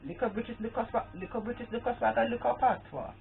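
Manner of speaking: high, lilting British-Caribbean patois; Britwa patois. Acted. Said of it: "British with a twang".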